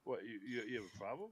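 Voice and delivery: low, quiet mock challenge; soft, low comic dog character; nonchalant dog voice. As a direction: play the unbothered dog talking back. Voicing the unbothered dog afterwards.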